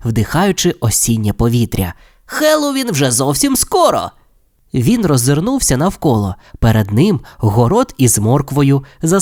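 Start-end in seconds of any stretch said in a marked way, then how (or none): 2.3-4.1 high, excited cheer without extra volume; eager exclamation. A narrator's reading. Steve looking forward to Halloween, exclaiming eagerly. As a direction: exclaim it high, full of anticipation. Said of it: "вигукнув він".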